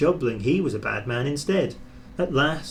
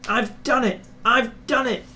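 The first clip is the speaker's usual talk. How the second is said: much higher, jubilant cry; triumphant; 11 semitones higher, 3 dB louder than usual. Acted. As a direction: crow about reaching the top. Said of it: "he puffed triumphantly".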